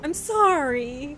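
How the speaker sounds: slow, drawn-out sorry; teary apology. Real, not acted. Streamer apologizing to the betrayed android, slow and sorrowful. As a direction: plead sorrowfully, stretching the words. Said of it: "getting teary already again".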